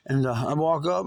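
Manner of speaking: ordinary delivery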